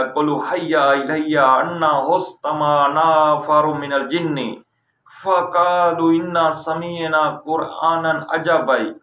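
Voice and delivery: slow, measured Arabic recitation; chanted Quran verse; Quranic recitation. Shown as a demonstration. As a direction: intone the verse slowly, like a prayer.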